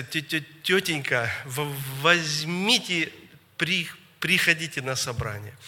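mild stammer on first syllables; stuttering. Retold or imitated. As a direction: stumble over the word starts, drawing them out. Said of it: "и он так заикается".

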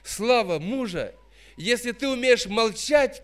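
normal talking voice

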